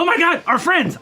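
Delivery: high, loud gasp of alarm; alarmed cry. Acted. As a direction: cry out in sudden panic for friends. Character suddenly remembers trapped friends.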